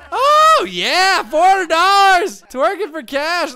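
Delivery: slow, gleeful cheer; excited. Real, not acted. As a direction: whoop over the cash pouring in. Sims rain money twerking.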